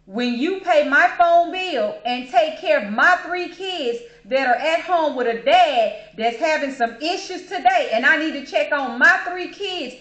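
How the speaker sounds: loud, high indignant clapback; forceful mock scolding; retold telling-off. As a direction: imitate a cashier reading him the riot act. Retold cashier telling customer off.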